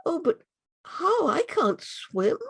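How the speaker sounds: put-on a lost child near tears; a lost girl telling the butterfly her fear; frightened girl. Girl fears crossing the river.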